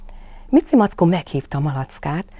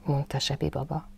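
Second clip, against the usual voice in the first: plain reading voice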